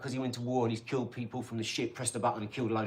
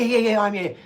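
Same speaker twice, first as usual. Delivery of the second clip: eager client; 8 semitones higher, 9 dB louder than usual. Acted. Voices excited client on phone.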